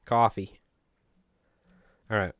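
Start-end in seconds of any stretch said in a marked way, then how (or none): 0.0-0.6 Coffee with a Long Island open "aw" sound, kʰɔfi; Coffee, drawn out Long Island "cawfee". Shown as a demonstration. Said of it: "You can hear my Long Island accent when I said coffee".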